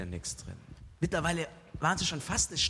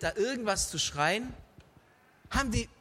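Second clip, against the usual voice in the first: ordinary talk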